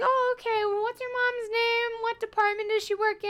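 high, put-on receptionist voice; front-desk lady. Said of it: "She's like".

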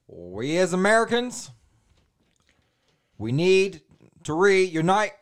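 a botched presidential impersonation; Obama. Acted. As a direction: orate in measured bursts with long pauses. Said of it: "That almost sounded like Ronald Reagan".

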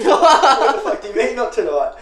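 loud, high, laughing disbelief; incredulous outburst. Retold or imitated. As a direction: shout it half laughing in disbelief. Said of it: "outraged reply to bouncer".

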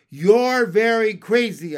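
high, loud verdict; loud, emphatic disbelief; astonished exclamation. A narrator's reading. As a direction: exclaim it at the reckless frog. Narrator exclaims at the frog.

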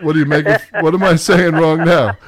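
real laughing protest at being teased; playful protest. Real, not acted. Host protests to co-host teasing his pronunciation, mock-indignant.

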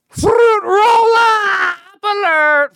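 very high, slow alarm-siren cry; alarm shout. Acted. Sounding the snack alarm, high siren wail.

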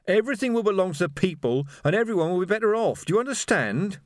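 high, pressing voice; insistent revolutionary. A narrator's reading. Revolutionary insisting to the doubting shoemaker, exasperated. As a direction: insist on it, pitched well up.

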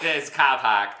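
loud mock Boston accent without r's. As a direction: belt out a hammed-up Boston accent. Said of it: "that wasn't a good Boston accent".

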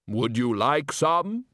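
slow, dry Death voice; bass voice. Acted. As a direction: speak as unhurried Death. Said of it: "you do have a very good bass voice".

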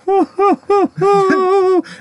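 high, quavering "hoo-hoo" whimper; mock whimper. Imitating the maid as the butler burns, high mock whimper. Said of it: "she's fucking whimpering".

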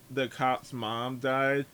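ordinary talk

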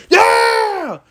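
very high, drawn-out yell; retold team cheer. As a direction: imitate a team roaring back. Team's answer to coach, retold.

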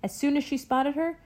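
ordinary delivery

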